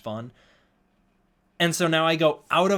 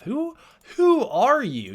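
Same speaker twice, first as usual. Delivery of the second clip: mock baffled; 11 semitones higher, 7 dB louder, slower than usual. Real, not acted. Baffled at unknown viewer.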